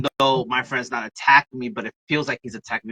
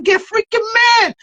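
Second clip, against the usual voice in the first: very high, loud outburst; exasperated mock shout; 8 dB louder than usual. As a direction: yell it in hammed-up exasperation. Exasperated retort to father, retold.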